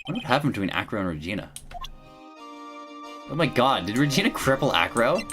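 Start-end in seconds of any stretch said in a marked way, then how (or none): none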